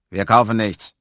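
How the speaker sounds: loud, gruff refusal; grumpy voice. A narrator's reading. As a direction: turn a caller away loudly and grumpily. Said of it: "sagte die Stimme". (brummig und laut abweisend)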